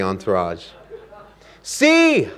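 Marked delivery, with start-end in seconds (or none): 1.6-2.3 sharp high cry, much higher and louder; king's cry. Acted. Canute crying out to his courtiers, high and loud. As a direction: call it out like a proud king. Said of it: "cried Canute".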